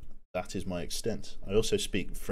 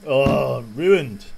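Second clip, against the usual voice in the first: loud, hammed-up groan of ruin; mock wail of ruin; mock dismay; 3 semitones higher, 9 dB louder than usual. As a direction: play up the spoiler outrage.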